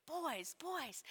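soft, whispery chiding; hushed reproof. Acted. Preacher reacting to the brothers' overconfidence, hushed reproof. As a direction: sigh it under your breath in dismay. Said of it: "I'm like".

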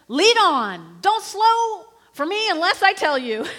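loud, urgent order to the servant; commanding shout. Acted. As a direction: bark the order, don't slow down. Shunammite woman ordering her servant.

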